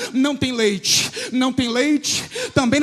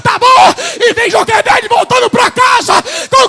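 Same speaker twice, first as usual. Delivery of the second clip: higher, louder climactic preaching; preaching at full force; 6 semitones higher, 8 dB louder than usual. Acted. Preacher crying out the queen's offer at full force. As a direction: build to a triumphant peak.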